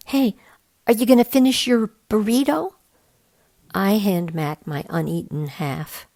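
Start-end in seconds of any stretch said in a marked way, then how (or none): 0.1-2.7 put-on raised boyish voice, louder; lightly raised kid voice for Max; Max's voice. A narrator's reading. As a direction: voice Max higher and brighter.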